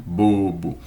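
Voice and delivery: low Bobo, both syllables buzzing; voiced b demo. Shown as a demonstration. Teacher has students practise bobo. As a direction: hum through Bobo. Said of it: "pra você treinar".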